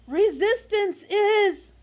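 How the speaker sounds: very loud, high, slow bellow; huge slow bellow. Acted. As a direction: fill your lungs and bellow. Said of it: "filled his lungs and bellowed".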